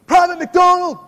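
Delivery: shouted drill sergeant bark. Retold or imitated. Drill sergeant yelling at recruit.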